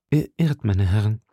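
understated, serious correction; earnest. A narrator's reading. Musketeer corrects his dining friends, earnest. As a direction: correct them soberly. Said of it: "entgegnete Athos ernst".

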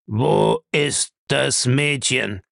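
slow, deliberate demand; stern interrogation. Acted. Bellamy demands the girl from Julius, stern. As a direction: press the question slowly, word by word.

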